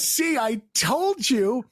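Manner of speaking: high, drawn-out told-you-so; triumphant vindication. Retold or imitated. Acted tripping vindication.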